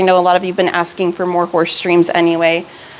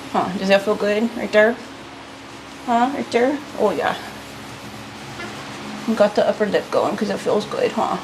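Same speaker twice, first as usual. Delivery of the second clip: soft soothing; 8 dB quieter than usual. Real, not acted. Soothing her horse while grooming.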